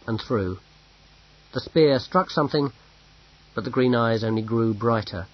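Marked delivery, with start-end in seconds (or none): none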